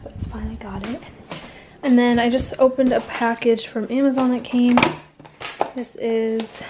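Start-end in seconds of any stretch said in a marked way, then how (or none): 0.0-1.0 soft unvoiced whisper. Real, not acted. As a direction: whisper it under your breath. Quiet aside while filming.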